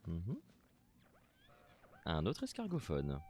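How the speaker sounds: ordinary talk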